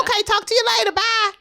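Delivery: high, singsong mock goodbye; pretend phone sign-off. Imagined casual phone sign-off.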